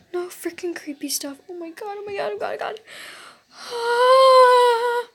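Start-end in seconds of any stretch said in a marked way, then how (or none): 0.1-2.8 soft frightened whispering; whisper, trembling with fear; scared whisper. Real, not acted. Scared whispering during horror video.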